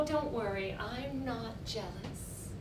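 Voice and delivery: normal talking voice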